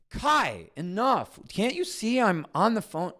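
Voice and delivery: put-on high, loud angry snap at the kid; a stressed dad losing his temper; snapping. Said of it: "I snap".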